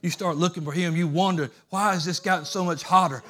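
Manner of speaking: loud, driving preacher's cadence; loud revival preaching; full-force preaching. Acted. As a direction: preach it hard to the congregation.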